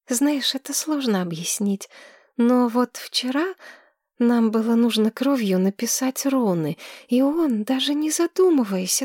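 put-on high, breathless girl gushing in love; dreamy young woman. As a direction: swoon over him like a smitten girl. Tamira gushes over her healer.

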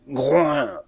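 falling animal grunt; drawn-out beastly snort; bear grunt. A narrator's reading. Narrator voices the bear's grunt.

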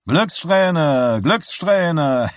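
high singsong cry with a laugh after; showman's call. A narrator's reading. Showman after paying out the winners, high singsong call. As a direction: call it out high, falling on each word.

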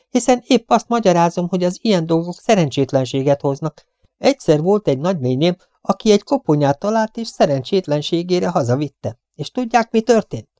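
high-pitched superstitious gossip; chattering cleaning woman, eager. A narrator's reading. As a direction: spin out the ominous family tale. Voices the talkative cleaning woman. (fecsegő, babonás takarítónő magas hangon)

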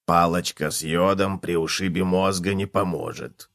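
low, soft character voice; chuckling cockroach. A narrator's reading. Cockroach teases the injured bedbug, drily amused. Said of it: "хохотнул таракан".

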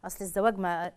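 sheepish soft mumble with no real answer; weak excuse. Pretend. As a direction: trail off like a caught-out husband. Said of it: "أنت تزوجت ليه؟".

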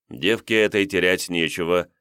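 usual speaking voice

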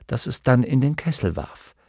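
plain reading voice

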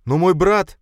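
high, loud anguished outcry; anguished cry. Acted. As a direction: cry out for a brother in dread. Prince cries out for brother.

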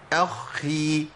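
اخي with the خ held long before the i; خاء مشددة مكسورة; doubled kha, kasra. Shown as a demonstration. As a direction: hold the خ in اخي then release. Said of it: "مع المشدد بكسر".

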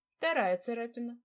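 wry, smirking understatement. Acted. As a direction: say it with a cocky grin. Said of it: "ухмыльнулся он".

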